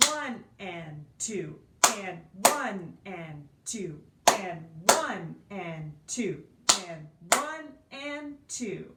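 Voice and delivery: slow, even one and two count; counting while clapping. Shown as a demonstration. Teacher claps the dotted rhythm for students, counting slowly. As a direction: say the syllables slowly on the beat. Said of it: "And I will say my syllables".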